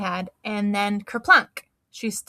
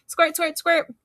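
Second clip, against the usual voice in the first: high, loud playful squirt sound; pretend squirting; 8 semitones higher, 4 dB louder, slower than usual. Girl pretending to spray seltzer, playful squirt noises. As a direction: make a toy seltzer noise. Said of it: "pretended to squirt myself with seltzer".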